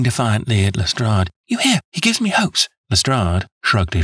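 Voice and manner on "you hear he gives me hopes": put-on high, eager plea from a devoted young woman; earnest young woman. A narrator's reading. Miss Turner begging Holmes to clear James, eager and high. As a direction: insist on his innocence, breathless with hope.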